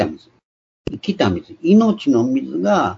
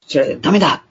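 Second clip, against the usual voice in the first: loud warning; 4 semitones higher than usual. Retold or imitated. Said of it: "特に大きな声で".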